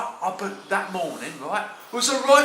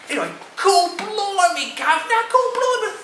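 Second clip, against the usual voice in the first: much higher, louder cockney amazement; amazed exclamation; 12 semitones higher, 4 dB louder than usual. Acted. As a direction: squeal it in disbelief.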